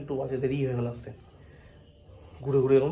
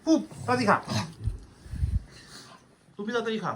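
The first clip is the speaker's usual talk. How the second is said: high-pitched dog talk; 9 semitones higher, faster than usual. Real, not acted. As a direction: squeak it up to hurry the dog. Urging the dog to eat.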